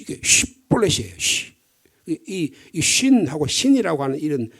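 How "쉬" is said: "쉬" as a short rough sh sound; a quick hissed "쉬". Shown as a demonstration. Preacher showing the shin sound, short sh. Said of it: "거친 쉬".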